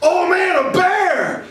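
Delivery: oh man, sudden high panicked yell; mock alarmed shout. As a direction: yelp it like you just spotted a bear. Sheriff sees bear.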